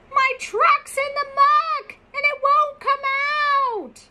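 high, distant-sounding duck call; mock shout. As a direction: call for help from far away. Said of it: "the ear that hears the shout".

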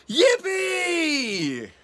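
loud, high drawn-out cheer. Acted. Storyteller leading kids in a birthday cheer, high and loud. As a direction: whoop it out high.

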